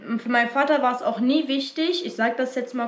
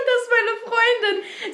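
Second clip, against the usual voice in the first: laughing through it; 12 semitones higher, slower than usual. Retold or imitated. Repeats retort while laughing.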